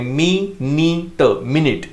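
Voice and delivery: मी, नी, ट said slowly as separate syllables then joined into मिनट. Shown as a demonstration. Teacher spelling out मिनट for Hindi learners, slow and separated. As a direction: break मिनट into मी नी ट, then say it whole. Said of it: "उसको मिनट बोलते हैं".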